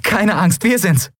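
high, happy call; relieved, high-pitched cheer; jubilant reassurance. A narrator's reading. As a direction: reassure him joyfully. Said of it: "jubelte Endriel".

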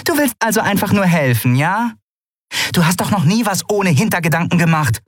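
high, sneering scorn; mock him, you don't believe a word; scoffing woman's voice; mocking Nelen. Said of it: "höhnte Nelen".

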